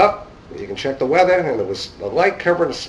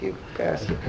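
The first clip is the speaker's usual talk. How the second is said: mock whisper; 7 dB quieter than usual. Joking retort to instructor's jab.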